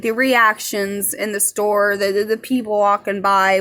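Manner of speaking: usual speaking voice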